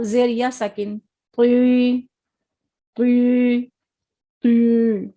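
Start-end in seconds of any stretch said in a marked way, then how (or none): none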